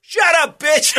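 high, loud, hammed-up yell; mock shout. Guy in crowd yelling at lady, mock shout. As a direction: bark it across the room.

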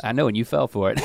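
ordinary talk

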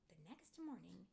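plain reading voice

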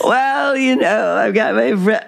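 a hint of a raucous party girl; raucous Cheers-era voice. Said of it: "then I would sort of talk".

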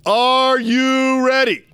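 stretched-out, loud announcer call; rally the crowd like a ring announcer; hype call. Shown as a demonstration. Hyping the show opener.

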